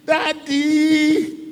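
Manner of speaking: high, drawn-out cry of "Daddy"; sustained plaintive call; anguished calling out. Acted. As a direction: call out to a father, long and high. Acted cry to the father.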